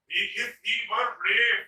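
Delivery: slow, stretched demo sentence; slow example. Shown as a demonstration. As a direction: spell out the example slowly. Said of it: "अगर positive degree के बाद क्या नहीं है". (धीमा उदाहरण वाक्य)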